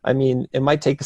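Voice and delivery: ordinary talk, nothing marked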